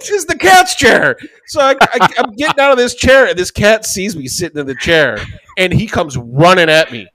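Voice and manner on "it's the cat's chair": high, slow shouted reveal; emphatic punchline. Real, not acted. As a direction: belt out the punchline slowly.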